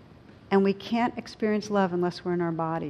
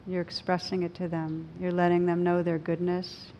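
guided meditation. Acted. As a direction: gently guide them inward.

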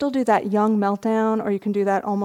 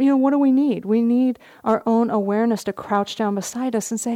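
ordinary delivery

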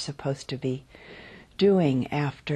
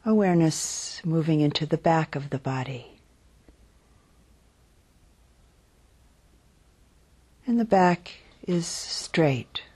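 unhurried, calm body-scan voice; guided meditation. Real, not acted. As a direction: guide them gently into settling, phrase by phrase. Leads a guided body-awareness meditation.

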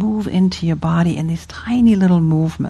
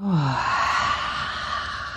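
soft, falling exhaled "ah"; long breathy sigh; 4 semitones lower, 10 dB quieter than usual. Shown as a demonstration. Teacher pausing to breathe with class, long soft sigh. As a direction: let all the breath go slowly. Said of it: "give your breath a little bit of sound".

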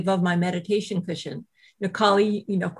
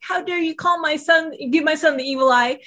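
angry retort; 6 semitones higher than usual. Retold or imitated. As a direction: hit back in a retold quarrel. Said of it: "we were going at it".

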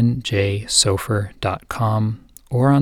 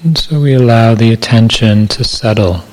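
calm, measured meditation voice with long silences; guided meditation; 6 dB louder than usual. Acted. Meditation teacher settling listeners into body awareness, calm guided meditation. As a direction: guide listeners gently into body awareness, unhurried, evenly paced.

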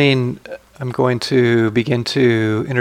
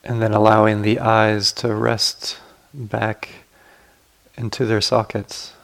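guided meditation. Acted. Teacher guiding sitters to relax the body, calm guided meditation.

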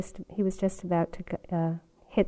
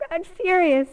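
usual speaking voice

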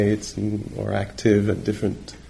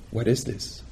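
soft inquiry; 6 dB quieter than usual. Retold or imitated. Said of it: "she would say".

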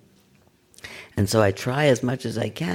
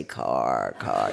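name it like it doesn't matter; mock detached noting. Teacher mocking noting a careening car, dreamy and low. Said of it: "you don't say".

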